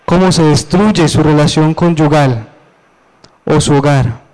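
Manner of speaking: ordinary delivery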